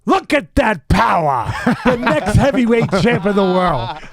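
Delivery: loud, high, triumphant shout; imitated booming delight, stretched out; exultant proclamation. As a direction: proclaim it in awe like a champion's been found. Retold exultant outburst over spill.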